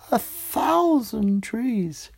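high, slow, wondering exclamation; amazed. A narrator's reading. Narrator marvelling at the orchard, high and slow. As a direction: let the wonder stretch out.

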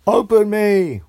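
high, loud shout of many doors; shouted chorus. A narrator's reading. All the doors shouting at the girl together.

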